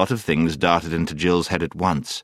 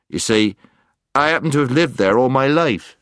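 louder, raised in protest; firm objection; 7 semitones higher, 5 dB louder than usual. A narrator's reading. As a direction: insist firmly. Puddleglum insists.

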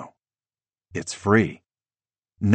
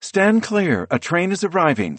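high, urgent station announcement; urgent announcer voice; 11 semitones higher than usual. A narrator's reading. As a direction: blare it like a platform speaker. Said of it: "An urgent female voice blares through a speaker overhead".

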